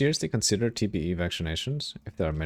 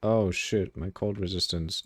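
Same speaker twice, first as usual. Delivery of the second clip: ordinary talk, nothing marked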